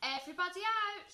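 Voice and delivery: high, loud, quick order; shouting. A narrator's reading. Teacher clearing the class for fire, shouting. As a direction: shout an urgent evacuation order. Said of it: "shouted Miss Perks".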